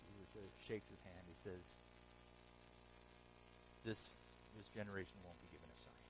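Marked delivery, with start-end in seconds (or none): 3.8-6.0 low, soft and quick, almost under the breath; quiet refusal. Retold or imitated. Jesus quietly refusing the Pharisees a sign, soft and quick. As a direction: dismiss them quietly and move on.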